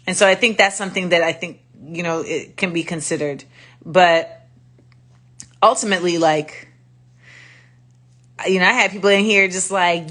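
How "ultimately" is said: usual speaking voice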